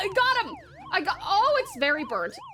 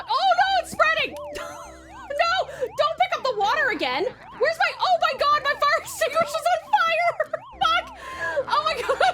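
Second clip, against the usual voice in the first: frantic laughing panic; 12 semitones higher, 4 dB louder than usual. Real, not acted. Fire spreads, laughing panic.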